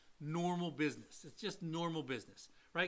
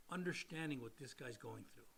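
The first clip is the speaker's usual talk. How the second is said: usual speaking voice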